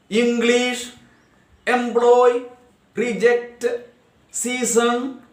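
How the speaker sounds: high, measured word list in two beats each; syllables spoken separately. Shown as a demonstration. Teacher reading disyllabic examples, syllables spoken separately. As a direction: give both syllables of each word their own beat. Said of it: "two syllables spoken separately".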